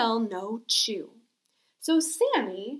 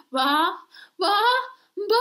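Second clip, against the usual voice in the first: building sneeze; 7 semitones higher, 4 dB louder than usual. Acted. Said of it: "pepper in Sammy's face".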